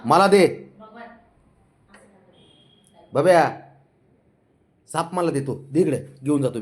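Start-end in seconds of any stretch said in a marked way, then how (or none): 0.0-0.7 loud coaxing call to the cat; scolding the cat to hand over the snake, loud. Real, not acted. Man pleads with cat for snake. 3.1-3.6 loud call of the cat's name; sharp call across the room. Real, not acted. Calling the cat by name.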